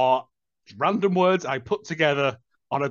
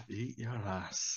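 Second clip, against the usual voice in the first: muttered quote; 7 semitones lower, 17 dB quieter, slower than usual. Pretend. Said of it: "quoting punchline quietly".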